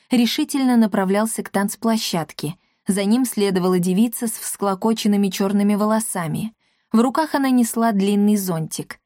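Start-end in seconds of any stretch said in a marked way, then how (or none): none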